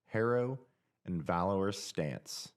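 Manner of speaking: normal talking voice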